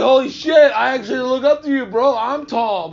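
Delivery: high, loud acted-out astonishment; loud, high-pitched mock shock; retold amazement. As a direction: exclaim it big, as if looking up in awe. Re-enacts amazement at tall man.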